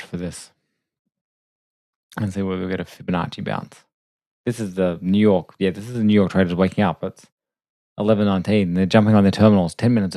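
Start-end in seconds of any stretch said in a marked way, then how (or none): none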